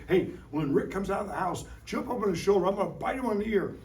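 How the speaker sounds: high, fast, scheming squirrel chatter; talking squirrel. Acted. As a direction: play a sneaky squirrel plotting a raid on the house. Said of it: "The squirrel goes".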